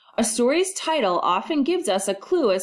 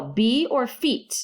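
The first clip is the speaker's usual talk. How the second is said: normal talking voice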